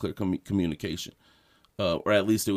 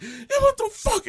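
very high, angry retort; 3 dB louder than usual. Retold or imitated. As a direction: snap back in outrage. Friend joins the cussing.

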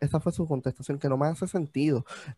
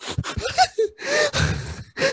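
high laugh. Real, not acted. Mocking the crying interview.

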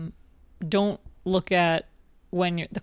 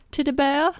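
high, louder drawled catchphrase with rising end; approximated character voice; 8 semitones higher, 5 dB louder than usual. Acted. Co-host naming the character invented today, in character voice. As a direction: pipe it up like a little Southern child. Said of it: "a voice that was approximating a character".

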